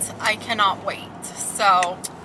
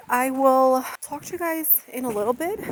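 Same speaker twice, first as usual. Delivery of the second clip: ordinary delivery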